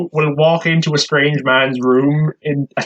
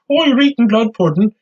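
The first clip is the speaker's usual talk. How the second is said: mocking the film line; 8 semitones higher than usual. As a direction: parrot the silly film line in a raised voice.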